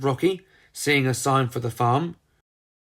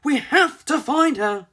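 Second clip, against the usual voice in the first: urgent; 12 semitones higher than usual. Acted. Ginger panicked after Molly.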